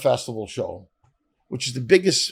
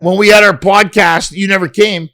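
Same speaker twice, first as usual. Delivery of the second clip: mock snub; 4 semitones higher, 9 dB louder than usual. Voicing the snubbing star.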